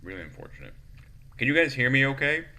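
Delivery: normal talking voice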